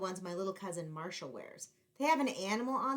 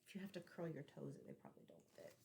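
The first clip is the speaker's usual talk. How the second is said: very soft, breathy whispered aside; quiet aside; 4 semitones lower, 16 dB quieter, faster than usual. Acted. Narrator whispering a wry aside to listeners. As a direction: murmur it as a knowing aside.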